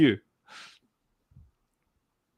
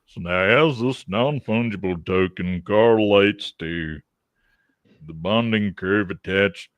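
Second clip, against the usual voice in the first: deep Southern voice; 4 semitones lower than usual. Acted. Host explaining crypto with big words, deep Southern accent. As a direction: deliver jargon in a low Southern US accent. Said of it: "you gotta use words like correlation".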